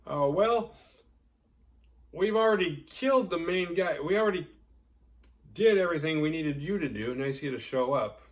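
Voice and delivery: high, loud sarcasm at the cops; teen survivors mocking the cops; sarcastic high mock voice. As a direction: needle the late cops. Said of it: "It's like".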